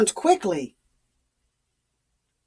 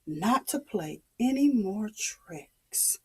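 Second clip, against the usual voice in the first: hushed; 8 dB quieter than usual. A narrator's reading. Solemn vow, hushed.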